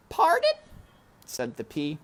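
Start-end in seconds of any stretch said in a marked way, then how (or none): 0.1-0.6 very high, loud query; princess asking. A narrator's reading. Princess asking Mig, high and loud. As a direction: ask her to repeat.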